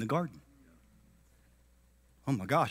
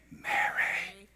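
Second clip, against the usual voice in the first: unvoiced tender whisper; whispered name; 2 semitones higher, 12 dB quieter than usual. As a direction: breathe her name softly. Jesus whispering her name.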